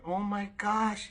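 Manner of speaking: high, drawn-out astonishment; acted wonderstruck woman; imitated amazement. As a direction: mimic a woman overwhelmed, slow and high. Voicing the amazed delivery woman.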